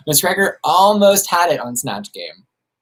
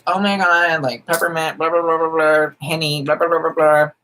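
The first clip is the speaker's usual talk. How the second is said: higher-pitched chattering stan imitation; mock gushing fans; 4 semitones higher than usual. Streamer mimicking Twitter stans' empty hype, mock gushing. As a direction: imitate empty online hype, high and running on.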